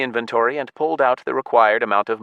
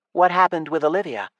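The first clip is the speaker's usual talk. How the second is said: put-on higher young voice; elf girl; 10 semitones higher than usual. A narrator's reading. Elf girl asking Walsh about Olivia, higher voice. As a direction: ask with curiosity.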